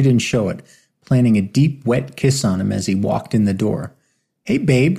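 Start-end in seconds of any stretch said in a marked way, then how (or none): 4.4-5.0 higher, bright greeting; exclaimed. A narrator's reading. Lily greeting Martin at the door, excited. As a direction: sing out a happy hello. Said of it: "she exclaimed".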